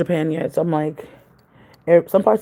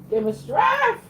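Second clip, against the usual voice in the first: high desperate cry to the Lord; praying for patience through teeth; much slower than usual. Real, not acted. Exasperated with child, praying.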